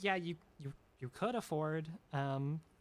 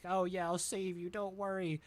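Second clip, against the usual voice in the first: higher-pitched mock-helpful teammate; acted breezy reassurance; reassuring teammate; 5 semitones higher than usual. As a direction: play an overconfident rescuer. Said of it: "their teammates throws a choke".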